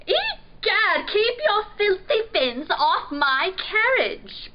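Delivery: high, loud princely outrage; outraged exclamation. A narrator's reading. Prince defending his carriage from the pirate, exclaiming. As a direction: exclaim like an affronted royal. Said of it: "Prince exclaimed".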